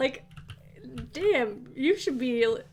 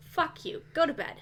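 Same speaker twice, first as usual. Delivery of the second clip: normal talking voice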